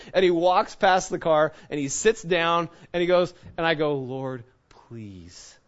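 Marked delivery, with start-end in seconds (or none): 4.0-5.6 soft, low whispered prayer; whispered plea. Retold or imitated. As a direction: whisper it as a private plea to God. Retold desperate private prayer.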